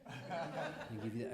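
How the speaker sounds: calm director. Retold or imitated. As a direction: calmly reassure a nervous mugger, unhurried. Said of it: "I was very calm".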